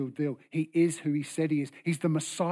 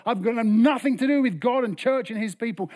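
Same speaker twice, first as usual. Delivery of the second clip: indignant rejection; 5 semitones higher, 5 dB louder than usual. Acted. Acting people who blame God.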